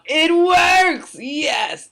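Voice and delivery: high, loud, drawn-out cheer of delight; excited shout. Real, not acted. Host thrilled her sound button works for viewers, excited shout. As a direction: celebrate the button finally working.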